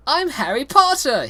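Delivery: high loud yell. Acted. Reader voices Harry yelling his name, high and loud. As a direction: shout it proudly. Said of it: "he began yelling".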